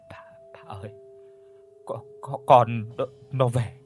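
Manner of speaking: stuttering in fear, starting in a whisper; broken, frightened halting speech; terrified stammer. Acted. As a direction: shaken old man stumbling over his words. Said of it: "Hàm răng va vào nhau nghe lách cách".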